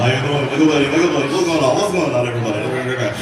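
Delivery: loud, hammed-up Diego greeting the customers; imitating Diego. Comic doing Diego greeting customers, loud mock host. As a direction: belt out a cheesy host's hello. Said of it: "I have to imitate Diego".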